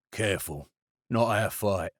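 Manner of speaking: a lightly voiced low, quiet caution; Varlo, low warning. A narrator's reading. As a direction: murmur the warning. Varlo restrains Kalidra.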